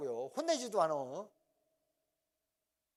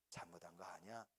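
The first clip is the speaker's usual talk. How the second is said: hushed, gentle father asking softly; much softer, tender father; soft fatherly voice; 9 semitones lower, 13 dB quieter than usual. Retold or imitated. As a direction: coax the son like a kind dad. Father gently forgiving in story.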